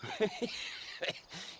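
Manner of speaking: a quiet knowing laugh; sly chuckle. Acted. Old man laughing at the stranger, sly chuckle. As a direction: chuckle under your breath at him.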